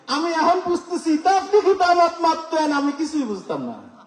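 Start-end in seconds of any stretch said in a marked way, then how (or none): 1.2-2.7 higher Sylheti mimic; a light Sylheti question; Sylheti dialect. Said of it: "সিলেটের ভাষার কথা".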